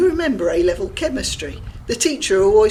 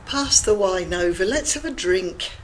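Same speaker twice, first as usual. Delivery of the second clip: ordinary delivery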